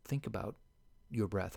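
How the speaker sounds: ordinary delivery, nothing marked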